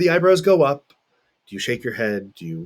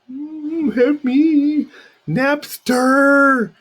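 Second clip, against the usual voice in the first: high, loud plea for help recalling a name; a pitched-up groping cry of "help me"; straining to remember; 13 semitones higher, 6 dB louder, much slower than usual. Real, not acted. Teacher to class, groping for a forgotten name, high and loud.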